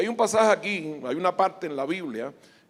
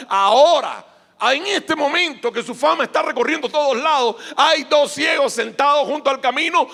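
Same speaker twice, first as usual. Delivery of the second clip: forceful, raised sermon delivery; preach; 6 semitones higher, 8 dB louder than usual. Real, not acted. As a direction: build the case loudly for the congregation.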